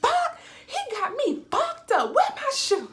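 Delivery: high, loud, shrieking disbelief; loud mock outrage with a laugh in it; outraged best friend. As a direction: shriek it like a friend who can't believe what she's seeing. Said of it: "She was like".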